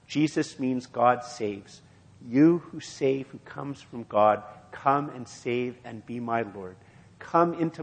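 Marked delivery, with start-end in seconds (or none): none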